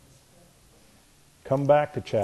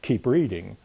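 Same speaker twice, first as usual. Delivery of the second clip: normal talking voice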